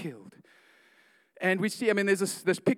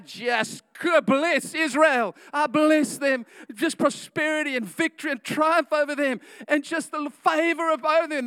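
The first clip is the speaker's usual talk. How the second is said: mock prophetic blessing; 7 semitones higher, 6 dB louder than usual. Balaam blessing Israel instead.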